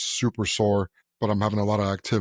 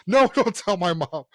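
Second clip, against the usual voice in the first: high, laughing plea; acted panicked plea; 8 semitones higher, 3 dB louder than usual. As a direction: beg in mock panic, breaking into a laugh. Son begs dad not to tell.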